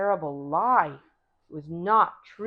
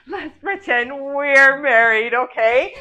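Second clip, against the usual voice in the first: louder, higher, slow pleading tease; pushy insisting; 6 semitones higher, 12 dB louder, slower than usual. Acted. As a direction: press him harder this time, loud and drawn out. Wife insists the next day.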